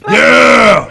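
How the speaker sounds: high, roaring party-animal yell; wild enthusiasm. Acted. Drinker smashes a jar on his forehead, enthusiastic yell. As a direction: bellow it like you just crushed a can. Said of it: "I love your enthusiasm".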